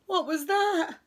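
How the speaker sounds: high, loud, quick start of alarm; startled question. A narrator's reading. Friends hear tapping at the door, startled. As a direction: jump at a sudden noise.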